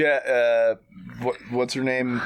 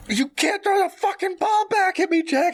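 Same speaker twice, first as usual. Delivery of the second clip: put-on angry childish yell. Grown son yelling at the mannequin, high and angry. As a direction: yell at the doll like a furious kid. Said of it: "getting angry".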